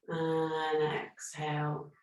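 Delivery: calm, unhurried meditation guidance; guided breathing meditation. Acted. Yoga teacher guiding her online class through breathing, calm and soothing.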